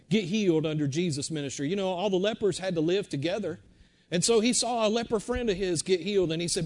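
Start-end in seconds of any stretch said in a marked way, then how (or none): none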